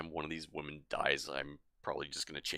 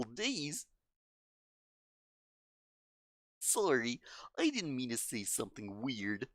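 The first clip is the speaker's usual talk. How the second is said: higher, caring schoolgirl voice; gentle girl voice; 11 semitones higher than usual. Acted. Voices Monika's dialogue.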